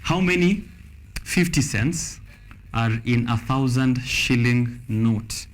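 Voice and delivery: first reading of the quiz question, drawn out with pauses; slow quiz read. Shown as a demonstration. Quizmaster reading a question to the contestant, slow and spaced. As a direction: read it slowly and spaced so players can write it down. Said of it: "We have ten seconds".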